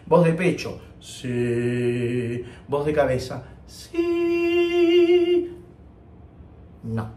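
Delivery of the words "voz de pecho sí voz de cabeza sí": mocking what people say. Teacher mimicking how students wrongly demo head voice, lightly voiced. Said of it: "mucha gente te dice".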